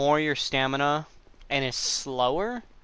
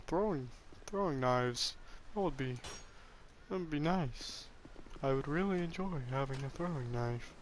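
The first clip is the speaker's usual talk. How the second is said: softer, strange put-on character voice; odd put-on voice; 7 dB quieter than usual. Real, not acted. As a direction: talk it like some weird frustrated character. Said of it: "I don't know why I'm talking like that now".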